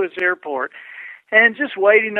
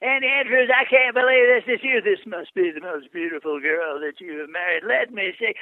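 Zig Ziglar impression. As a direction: gush over a new acquaintance in his voice. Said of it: "Andy does a great impression of you".

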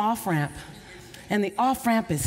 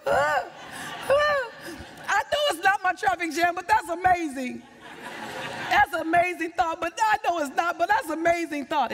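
overwhelmed, high and emotional; 7 semitones higher than usual. Retold or imitated. Retelling the traffic jam moment, high and overwhelmed.

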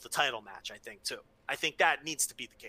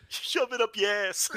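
put-on echoing New York accent; 5 semitones higher than usual. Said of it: "You sounded like a cab driver".

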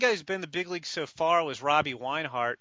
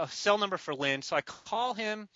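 ordinary talk, nothing marked

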